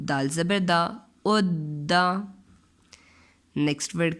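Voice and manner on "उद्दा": "उद्दा" drawn out very slowly, द held; slow doubled demo. Shown as a demonstration. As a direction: hold the द before releasing. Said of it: "next word है". ("उद्दा" को बहुत धीरे, द दोहराकर)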